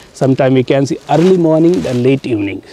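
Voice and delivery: ordinary talk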